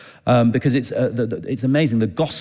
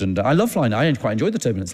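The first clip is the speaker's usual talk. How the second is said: ordinary talk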